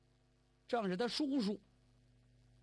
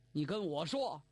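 plain reading voice

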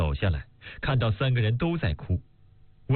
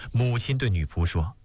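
ordinary delivery

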